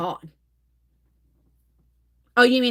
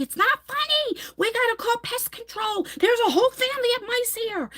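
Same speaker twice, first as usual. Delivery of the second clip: high-pitched panic, much higher than her usual voice; frantic mom voice, pitched way up; panicked retelling; 12 semitones higher than usual. Retold or imitated. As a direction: squeal it like you just found mice everywhere. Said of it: "and I'm like".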